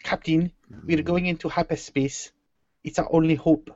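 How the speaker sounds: measured South African impression; South African accent. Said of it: "South African is like Australian".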